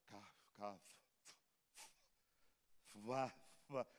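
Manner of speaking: drawn-out stammer on each syllable; stuttering. Retold or imitated. Pastor imitating his childhood stutter on cavalo, stammering. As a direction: stumble over the word as a stutterer. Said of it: "eu tava falando cavalo".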